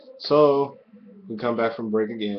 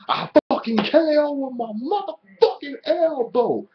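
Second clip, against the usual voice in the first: much higher, louder mock tough-guy boast; Wade Barrett brag; 5 dB louder than usual. A fan voicing the wrestler's boast, hammed-up and high. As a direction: ham up a cocky wrestler bragging. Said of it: "says Wade Barrett".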